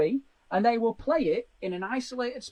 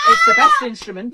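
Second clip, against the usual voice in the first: loud exclaimed; 12 dB louder than usual. Shown as a demonstration. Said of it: "But she said".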